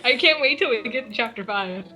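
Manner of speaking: ordinary talk, nothing marked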